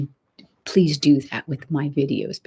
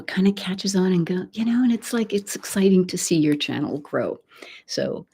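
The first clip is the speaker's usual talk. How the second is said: ordinary talk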